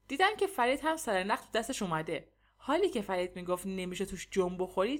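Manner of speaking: usual speaking voice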